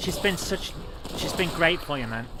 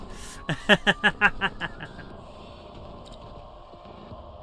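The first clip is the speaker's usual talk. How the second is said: helpless laugh; 4 semitones higher than usual. Real, not acted. Gamer amused at own made-up nonsense, fading laugh. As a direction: crack up, then let it fade out.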